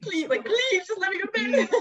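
high, loud half-laughing whine; whiny begging. Retold or imitated. Exhausted friend begging the roommate to let her sleep, high whiny plea. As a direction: beg like you're about to cry from laughing.

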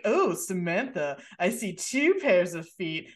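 slow, drawn-out, vaguely British hairdresser; Stewie. Retold or imitated. Hairdresser catching Samantha out, in a slow Stewie-like voice. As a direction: do an affected British hairdresser, stretching the words. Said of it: "I don't know why he sounds like Stewie".

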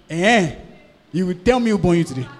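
put-on loud, fast scolding of a cheeky child; mock parent scolding. Outraged parent scolding a rude son, loud and fast.

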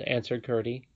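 ordinary delivery

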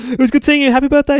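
high, loud parting call; calling out goodbye. Acted. Gabby calls to the Cuckoos as they leave, high loud call. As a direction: call it out high to someone walking away.

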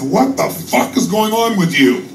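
big lion yelling high at the cub; angry big lion. Acted. Big lion scolding the confused cub, high yelling voice. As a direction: snap at him like an angry lion. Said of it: "and says".

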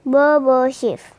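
plain reading voice

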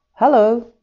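loud high greeting called up into the tree; calling out together. Acted. The family calling up to the little morepork, loud greeting. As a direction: call it out cheerfully to the morepork. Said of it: "everyone called out together".

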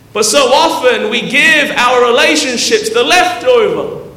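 high, loud sermon delivery; full-voiced, emphatic pulpit cadence; impassioned preaching. Real, not acted. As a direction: preach it out with rising conviction.

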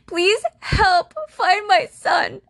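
high, loud, slow fake crying; mock sobbing plea. Mocking the mother's Facebook plea with fake sobbing. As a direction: ham up a tearful plea. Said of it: "took to Facebook crying and begging".

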